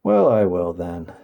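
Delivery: discouraged. A narrator's reading. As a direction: sigh it out, a bit let down. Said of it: "rather discouraged".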